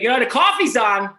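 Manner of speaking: loud, high, pushy reminder; dad voice. Retold or imitated. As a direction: do the dad nudging about coffee. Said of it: "my dad does the same thing".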